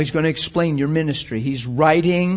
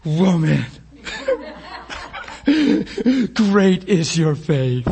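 slow, amazed praise broken by laughter; delighted laughing; 4 semitones higher, slower than usual. Acted. Jesus marvels at the woman's faith, laughing.

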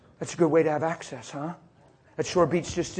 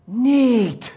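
awestruck; 8 semitones higher, 8 dB louder than usual. Retold or imitated. Awestruck man after prayer.

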